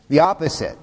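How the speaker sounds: normal talking voice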